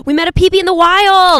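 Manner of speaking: loud, high burst of excitement; excited gasp. Real, not acted. Excited shout on meeting a fan.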